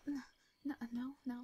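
ordinary delivery, nothing marked

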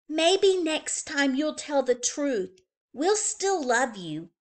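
sisterly comfort. A narrator's reading.

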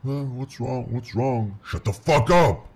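high-pitched outburst rising to a loud yell; agitated outburst. Acted. Demon takes over, snaps at partner.

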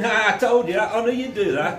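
what Jesus did not say, jeered high and loud; mock told-you-so. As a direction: sneer "I knew it" at him. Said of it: "I don't think Jesus said".

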